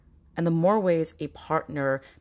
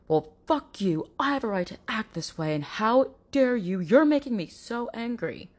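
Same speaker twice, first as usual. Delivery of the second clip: hammed-up rant working into a fury; angry partner; 6 semitones higher than usual. Abusive partner blaming victim, building mock anger. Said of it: "Or do they go".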